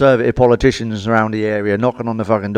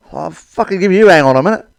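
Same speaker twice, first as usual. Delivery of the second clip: indignant retort; 6 semitones higher, 5 dB louder than usual. Retold or imitated.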